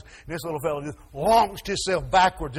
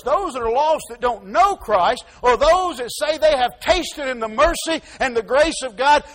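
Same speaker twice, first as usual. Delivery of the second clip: usual speaking voice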